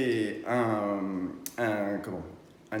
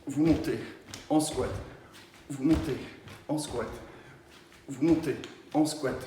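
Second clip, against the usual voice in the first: rhythmic coaching cues; 2 semitones higher than usual. Shown as a demonstration. Coach cueing exercise reps.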